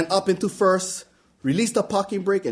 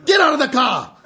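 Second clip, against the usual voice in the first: higher, louder furious shout; angry scream; 7 semitones higher, 4 dB louder than usual. Retold or imitated. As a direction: scream the order. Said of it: "he screamed".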